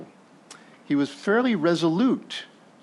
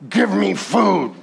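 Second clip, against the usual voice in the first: slow, louder demand; hungry Esau; 4 semitones higher, 4 dB louder, slower than usual. Acted. Preacher voicing starving Esau to his brother, slow hungry demand. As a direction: Esau in a blunt, famished grab for food. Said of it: "literally what he's saying is".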